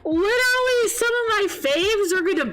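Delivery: high, worked-up lament; exasperated venting. Real, not acted. Fan telling viewers about the favorites they will miss, exasperated. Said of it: "I'm so mad at him".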